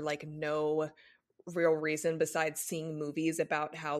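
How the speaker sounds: ordinary talk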